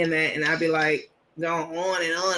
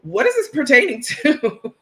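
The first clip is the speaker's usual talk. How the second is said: higher and louder, laughing through the prim line; laughing uppity repeat; 6 semitones higher, 5 dB louder than usual. Retold or imitated. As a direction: repeat the snooty question cracking up. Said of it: "y'all know I'm an uppity Negro".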